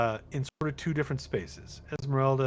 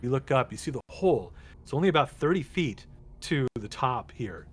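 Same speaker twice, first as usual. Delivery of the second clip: ordinary talk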